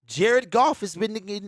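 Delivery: usual speaking voice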